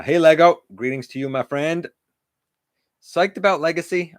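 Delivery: ordinary talk, nothing marked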